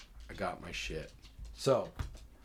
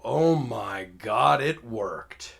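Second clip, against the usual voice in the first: drawn-out, loud "oh my God", settling to usual; mock amazement, stretched and loud; amazed relief; 9 dB louder, much slower than usual. Caller answers the officer's callback, amazed.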